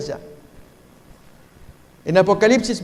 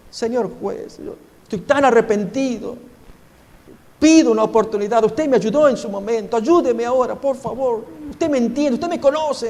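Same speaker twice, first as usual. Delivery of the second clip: pleading higher and higher, close to tears; a young man begging the judge, voice climbing; tearful plea, rising; 4 semitones higher than usual. Acted. A repentant young man begs the judge for mercy, tearful plea. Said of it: "Y ahí llorando".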